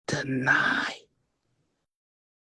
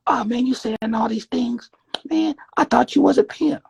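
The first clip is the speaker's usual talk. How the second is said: acted pimp; 7 semitones higher, 3 dB louder than usual.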